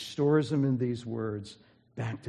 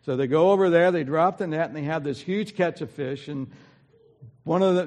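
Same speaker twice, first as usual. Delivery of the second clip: louder, higher preaching, full force; fervent preaching; 4 semitones higher, 8 dB louder than usual. Acted. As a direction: preach the fishing story with rising fervour.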